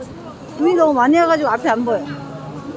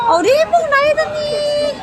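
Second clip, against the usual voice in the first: excited shout; 10 semitones higher than usual. Real, not acted. Greeting a viewer on stream.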